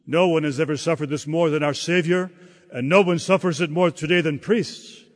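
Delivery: normal talking voice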